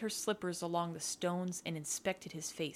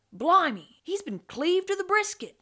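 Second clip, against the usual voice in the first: high, loud, shocked pirate voice; blurting; 12 semitones higher, 8 dB louder than usual. A narrator's reading. Crewman seeing a shipmate cut open, blurting. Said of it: "one blurted".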